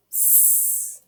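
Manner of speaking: a long, soft voiceless "S" hiss; letter sound. Shown as a demonstration. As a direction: hiss the "S" gently. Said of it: "do our sounds".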